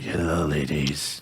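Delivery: slow, low, creepy come-on; creepy seductive. Acted. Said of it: "I just sound creepy".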